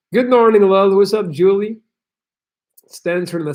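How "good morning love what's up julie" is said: bright, high greeting to the viewer; cheery greeting. Real, not acted. Streamer welcoming Julie in the chat, higher and cheery. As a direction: sing out a hello to a regular.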